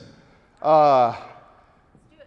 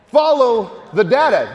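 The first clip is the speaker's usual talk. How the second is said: slow, loud and higher; emphatic shout; 5 semitones higher, 5 dB louder, slower than usual. Real, not acted. Speaker rallying the crowd to trust the data, loud and slow. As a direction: hammer home the key rule.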